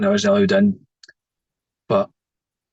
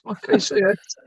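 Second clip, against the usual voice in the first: much higher mock-startled girl; imitating funeral parlour girl; 9 semitones higher, slower than usual. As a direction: imitate her sudden realisation. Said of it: "She went".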